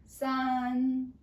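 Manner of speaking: "san" held loud, high and level; high flat tone. Shown as a demonstration. Teacher counts together with pupils, high flat tone. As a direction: keep "san" up on one note. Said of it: "keep at that pitch".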